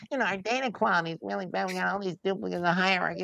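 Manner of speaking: high, fast mock whine; mock whiny data-quality moaner; whining.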